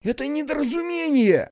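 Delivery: high-pitched frightened squeal; panicked squeal. Acted. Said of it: "завизжал Виктор".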